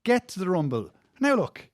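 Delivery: high, grinning with glee; smiling. Real, not acted. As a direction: say it through a smile. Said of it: "Why are you smiling when you say that?".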